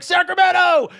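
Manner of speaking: high, loud shouted answer; triumphant shout. Retold or imitated. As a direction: yell the capital out.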